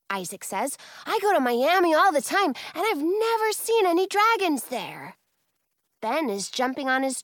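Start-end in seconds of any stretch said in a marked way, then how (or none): 1.1-5.2 put-on high, loud, fast boyish argument; excited pleading. A narrator's reading. Boy justifies seeing dragons, excited. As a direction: argue it eagerly at speed.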